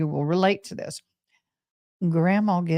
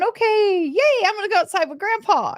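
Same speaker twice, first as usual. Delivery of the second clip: put-on much higher, fast and bright girlish cheer; excited little girl; 13 semitones higher than usual. Little girl answering grandpa's invitation, high excited cheer. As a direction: squeal it like a thrilled kid. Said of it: "and I'm going".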